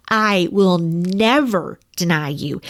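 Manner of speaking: "never" drawn out slowly with weight; emphatic. Retold or imitated. Peter insists to Jesus, emphatic weight on "never". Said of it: "He is emphatic".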